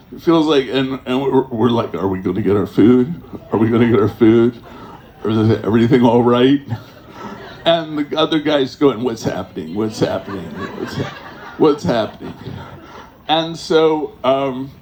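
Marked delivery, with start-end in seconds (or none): 1.9-6.6 fast, louder and lower, anxious; anxious hungry friends. Retold or imitated. Impatient hungry group asking loudly. 9.0-12.3 low, dazed mumbling repeat; dazed stoned friend. Retold or imitated. Same dazed friend voiced again.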